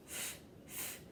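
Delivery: "f" as a voiceless puff of air, twice; letter sound demo. Shown as a demonstration. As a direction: blow "f" alone, not the letter name. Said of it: "What letter does f".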